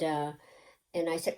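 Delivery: ordinary delivery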